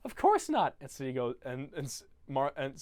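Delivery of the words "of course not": put-on high, loud flustered girl voice; high flustered girl denying a crush; mock girly denial. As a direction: brush it off too quickly. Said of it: "Cindy Lou Who goes".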